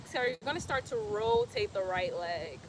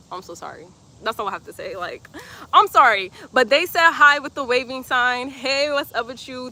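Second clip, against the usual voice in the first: ordinary delivery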